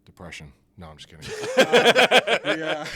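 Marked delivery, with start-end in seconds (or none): 1.4-3.0 laughing. Real, not acted. Laughing after joke.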